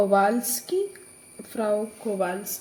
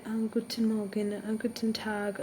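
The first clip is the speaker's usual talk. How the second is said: softer, understated German greetings; soft, timid reading; 7 dB quieter than usual. Shown as a demonstration. Teacher showing learners how not to practise German, soft and timid. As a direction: say the greetings softly, without any stress. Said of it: "പയ്യെ ഇങ്ങനെ".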